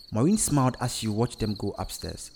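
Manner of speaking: usual speaking voice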